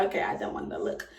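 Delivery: low breathy whisper, a bit fast; whispered aside. Retold or imitated. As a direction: whisper it to yourself, spooked. Reacting to seeing the placenta.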